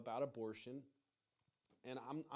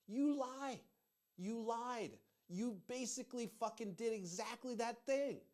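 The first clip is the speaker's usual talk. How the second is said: angry accusation; 6 semitones higher, slower than usual. Real, not acted. Accusing family of lying.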